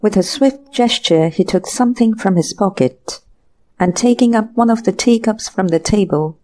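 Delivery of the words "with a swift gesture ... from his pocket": plain reading voice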